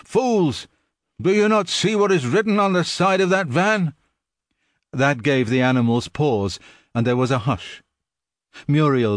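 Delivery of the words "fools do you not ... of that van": high, scornful outburst; angry shout. A narrator's reading. Benjamin shouting angrily at the animals. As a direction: rage at their blindness.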